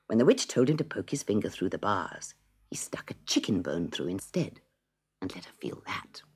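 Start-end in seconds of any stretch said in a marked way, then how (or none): 2.7-6.2 hushed narration. A narrator's reading. Trick on the witch.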